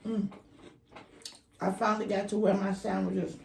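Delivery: usual speaking voice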